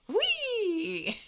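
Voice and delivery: very high, loud, drawn-out whee; gleeful squeal. Retold or imitated. Mimicking the serious friend tossing glitter, high gleeful squeal. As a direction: imitate a kid-like squeal of delight. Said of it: "and he's going".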